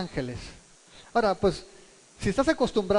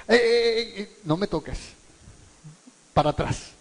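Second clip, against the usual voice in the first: mock rebuff. Imagined Jesus fending Mary off.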